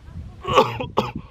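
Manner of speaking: harsh, rasping cough; coughing fit. Real, not acted. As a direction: cough hard. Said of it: "I'm coughing 'cause I'm talking so much".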